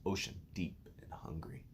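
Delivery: plain reading voice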